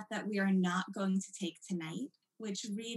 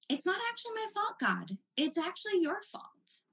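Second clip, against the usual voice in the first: much higher, fast, brazen mock whine; cheeky chutzpah; 9 semitones higher than usual. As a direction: toss the blame straight back at God. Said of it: "one of total chutzpah".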